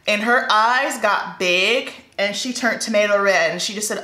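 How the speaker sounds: ordinary delivery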